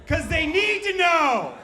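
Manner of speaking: high, loud, drawn-out holler; hammed-up high shout; mock yelling. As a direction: yell it over the crowd, stretching the end. Comic yells over crowd reaction.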